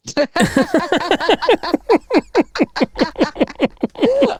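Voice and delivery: high, long helpless giggling; big laugh. Real, not acted. Joke-teller cracking up after the punchline, high long laugh.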